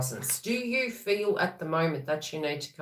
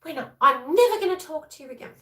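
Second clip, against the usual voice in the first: high, loud, fast mock outburst; huffy vow; 10 semitones higher, 4 dB louder, faster than usual. After a fight, a mock-furious vow never to speak again. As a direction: storm off in a playful huff.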